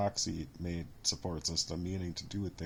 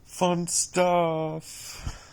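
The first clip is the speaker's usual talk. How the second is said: bitter sarcastic fun stuff, higher and louder; 8 semitones higher, 7 dB louder than usual. Real, not acted. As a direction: throw it out with biting irony. Bitter irony after listing losses.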